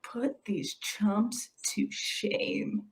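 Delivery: slow, breathy whisper. Real, not acted. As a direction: lean in and whisper it slowly. Conspiratorial aside to chat.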